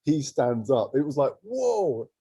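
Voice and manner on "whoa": high, drawn-out whoa; retold awe. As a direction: marvel at a sudden vision. Awe at Boris standing up.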